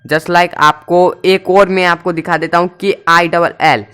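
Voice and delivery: ordinary talk, nothing marked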